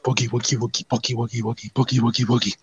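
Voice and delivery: low, soft chanting mutter; muttering to himself. Acted. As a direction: mumble it privately, under your breath. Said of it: "really slowly as he mutters to himself".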